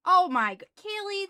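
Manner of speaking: ordinary talk, nothing marked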